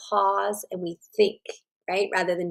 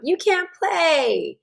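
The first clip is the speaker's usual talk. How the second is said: put-on high, slow, drawn-out child's taunt; mock playground taunt; 9 semitones higher, slower than usual. Playground kid telling the girl again she can't play, high mock taunt. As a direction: play the kid shutting her out, stretched and high. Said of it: "she hears again on the playground".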